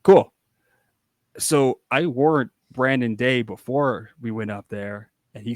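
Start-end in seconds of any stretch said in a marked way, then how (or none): 0.0-0.3 bursting cool. Retold or imitated. Brain says be Towel Boy.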